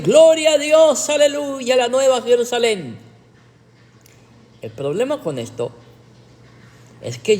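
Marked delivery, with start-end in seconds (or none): 0.0-3.0 mock ecstatic shouting. Preacher mimics Pentecostals shouting at the heavenly city, mock ecstatic shout. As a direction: imitate worshippers crying out in rapture, pitched high and stretched. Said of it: "empezaron a gritar los pentecostales".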